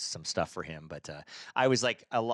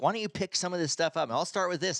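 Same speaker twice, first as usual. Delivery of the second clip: high-pitched furious wife; wife about to throw trash at him, pitched up; mock angry wife; 10 semitones higher than usual. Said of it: "she's like".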